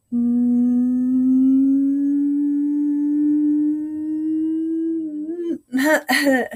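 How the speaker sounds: long sustained siren hum that breaks; race car hum with a voice crack. Shown as a demonstration. Teacher demos the race car pattern, voice breaking mid-hum. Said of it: "You heard mine".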